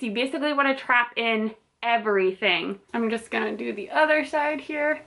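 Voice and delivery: muffled behind mask; speaking into mask fabric; through a face mask. Real, not acted. Seen 2: Blue disposable mask covers mouth and nose throughout labelled words.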